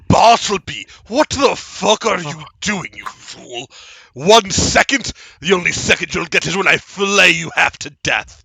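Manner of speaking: loud, raging shout down the line; enraged master yelling through the shell; furious boss. Acted. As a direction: scream at the fool underling.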